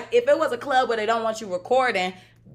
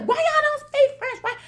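much higher, louder, outraged disbelief; mock the man's shocked question way up high; incredulous stranger; 4 dB louder than usual. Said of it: "He's like".

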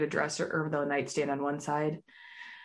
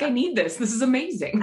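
excited; 6 semitones higher, 5 dB louder than usual. Retold or imitated. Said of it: "I was like".

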